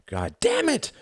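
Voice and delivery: high, sudden shout of pain; pained, high-pitched outburst. A narrator's reading. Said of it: "he shouted".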